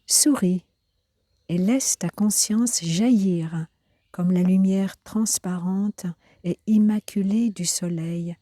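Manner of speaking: unhurried, carefully phrased poetry recitation; poem reading. Acted. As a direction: read the poem line by line, letting each phrase settle. Reads a poem aloud.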